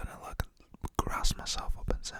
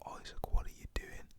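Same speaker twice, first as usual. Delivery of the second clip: usual speaking voice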